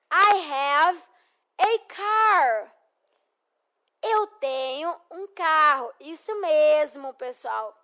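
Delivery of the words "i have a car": slow, clear "I have a car" with a lift on "car"; model for repeating. Shown as a demonstration. Teacher models for students to repeat, clear and slow. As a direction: say "I have a car" for the class to copy. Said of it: "Repitam comigo".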